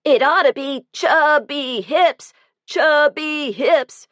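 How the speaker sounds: high, slow schoolyard jeer; a high, drawn-out jeer from a mean boy; mocking boy. A narrator's reading. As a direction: sneer it out to tease the fat kid. Boy taunts overweight classmate.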